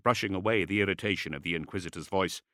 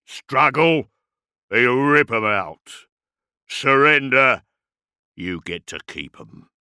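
loud, slow orc threat dropping away at the end; guttural brute giving an ultimatum; 4 semitones higher, 9 dB louder, slower than usual. A narrator's reading. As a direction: punch out the orc's commands, then ease down. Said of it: "His Gothic was guttural".

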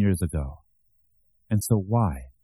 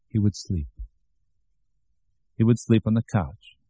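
ordinary delivery, nothing marked

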